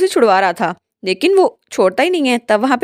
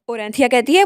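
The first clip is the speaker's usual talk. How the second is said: usual speaking voice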